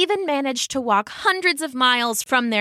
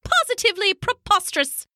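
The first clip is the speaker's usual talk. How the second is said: very high, fussy elder penguin; high-pitched old lady penguin; Pippo; 11 semitones higher, faster than usual. A narrator's reading. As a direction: pronounce it with indignant precision. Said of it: "the elder female penguin".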